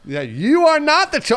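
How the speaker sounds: high, slow accusing wizard voice; mock Ollivander. Ollivander accusing a fan in line, slow and high. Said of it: "Ollivander voice continued".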